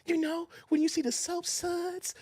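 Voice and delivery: hint of a nervous man's trembling voice; lightly imitating a shaky, timid delivery; quivering voice. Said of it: "remember his voice is quivering?".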